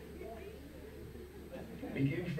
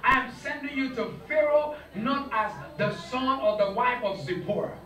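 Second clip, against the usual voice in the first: God's command, rising loud; 7 semitones higher, 5 dB louder than usual. Retold or imitated. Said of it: "He said".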